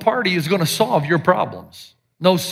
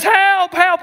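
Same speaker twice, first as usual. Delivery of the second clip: high, louder mock cry for help; call for help; 9 semitones higher, 3 dB louder than usual. Bodybuilder in the lion's den calling out, high mock panic. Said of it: "he says".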